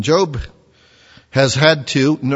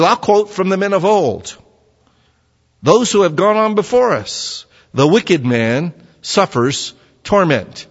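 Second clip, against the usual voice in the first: a touch higher, lightly voiced know-it-all elder; pompous Eliphaz; 5 semitones higher, 3 dB louder than usual. Preacher voicing Eliphaz lecturing the suffering Job, lightly smug elder voice. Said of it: "He says".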